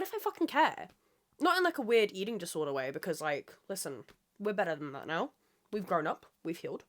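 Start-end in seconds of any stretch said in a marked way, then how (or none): none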